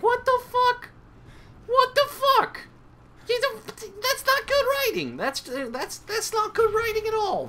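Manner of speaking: very high, squeaky incredulous rant; falsetto outrage. Acted. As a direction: protest bad writing in hammed-up falsetto. Outraged at the film's dialogue.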